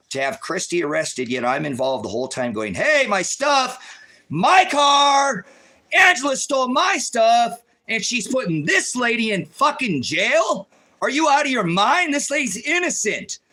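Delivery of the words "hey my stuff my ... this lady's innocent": retold outraged yell. Retold outburst at the police.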